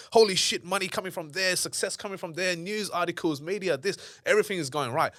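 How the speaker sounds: high-pitched, excited reeling-off of wins; re-enacted giddy excitement. Founder recalling the boom days of e-commerce, giddy excitement. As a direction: burst out loud, then tick off each windfall. Acting out thrill of booming business.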